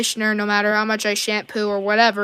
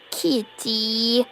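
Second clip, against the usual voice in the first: usual speaking voice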